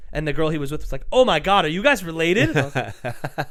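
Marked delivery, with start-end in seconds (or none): 1.1-2.5 loud, raised-pitch excited quote; imitated amazement. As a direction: gush like an astonished onlooker. Voices amazed girl in story.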